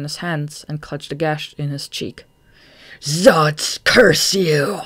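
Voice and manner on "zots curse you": loud, harsh shriek of a curse. A narrator's reading. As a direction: hurl the curse at full force. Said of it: "Goff shrieked".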